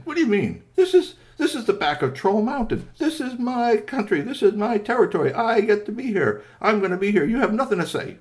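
put-on young troll arguing with Strongbones, indignant. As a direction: defend your turf angrily. Trolls argue.

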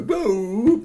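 loud, high rising "boop"; dropping sound effect. Acted. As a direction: voice a toy bouncing on a string. Imitates toy being dropped.

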